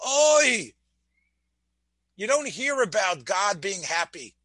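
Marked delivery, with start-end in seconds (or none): 0.1-0.7 a higher, louder cry of oy; lament oy. Acted. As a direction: let out a grieving oy. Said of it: "you know what you hear?".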